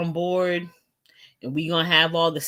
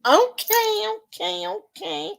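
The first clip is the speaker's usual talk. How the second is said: much higher, playful okays; sing-song agreement; amused; 14 semitones higher than usual. Real, not acted. Reacting amused.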